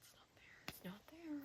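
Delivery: hushed, nearly voiceless whisper; mock-panicked whisper; whispered dismay. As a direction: whisper it to yourself in disbelief. Acts out failed pocket search.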